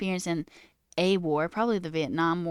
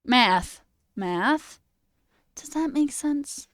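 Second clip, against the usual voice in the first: mock teacher, pitch jumping up on the question; imitated math teacher; 6 semitones higher, 4 dB louder, much slower than usual. Teacher asking a blank class, caricatured teacher voice. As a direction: caricature a teacher checking for understanding, ending high and slow. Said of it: "She'd be like".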